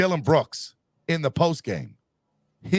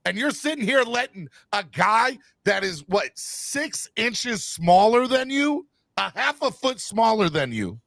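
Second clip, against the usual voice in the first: angry yelling; 7 semitones higher than usual. Real, not acted. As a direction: yell at the player in disbelief. Yelling at Anthony Davis.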